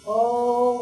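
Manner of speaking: sudden startled gasp of oh; loud surprised exclamation. A narrator's reading. Narrator exclaims at mishap.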